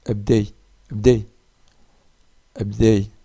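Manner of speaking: abdei said loud with the final i stressed; teacher calls out abdei for the class; abdei with added i. Shown as a demonstration. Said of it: "J'ajoute le i".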